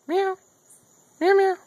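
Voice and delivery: very high mewing; fake cat meow. Owner teasing his dog as a cat, high meow. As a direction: imitate a cat in a high squeal. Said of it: "It's not a real cat".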